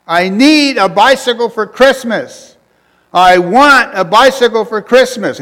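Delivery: high, loud, slow pleading yell; a kid bellowing up at heaven; child shouting his Christmas wish; boy yelling a prayer. Retold or imitated. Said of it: "crying, he's yelling".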